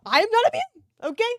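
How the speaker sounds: high, loud, insistent protest; emphatic confession. Real, not acted. Viewer admitting a weakness for sideburn guy, loud defensive confession. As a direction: own up loudly, pitched right up.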